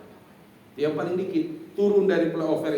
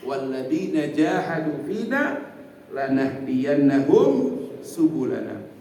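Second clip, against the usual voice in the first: slow recitation; 5 dB louder, slower than usual. Acted. Quran verse recited.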